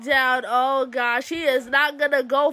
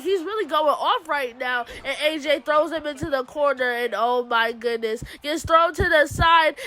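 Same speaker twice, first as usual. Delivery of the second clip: ordinary talk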